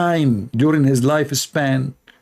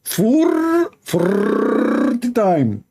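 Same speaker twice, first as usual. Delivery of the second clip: Four then forty times, high and very slow; mocking stress on forty; 6 semitones higher, much slower than usual. Shown as a demonstration. Mocking the forty Mecca trips, high and very slow. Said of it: "mocking emphasis".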